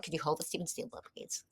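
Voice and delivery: quick, soft imitation of a formal operator; hotel operator. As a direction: lightly mimic the polite phone operator. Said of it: "And it was just like".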